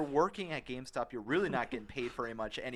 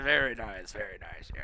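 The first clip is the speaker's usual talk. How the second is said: put-on old rich guy voice; 4 semitones lower than usual. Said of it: "Why do you always make that sound?".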